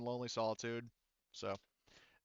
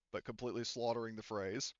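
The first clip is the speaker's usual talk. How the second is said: normal talking voice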